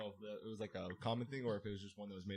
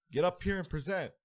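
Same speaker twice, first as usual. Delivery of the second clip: stern teacher; 7 semitones higher, 7 dB louder than usual. Retold or imitated. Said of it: "He's like".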